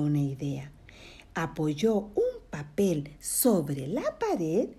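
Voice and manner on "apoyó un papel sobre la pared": usual speaking voice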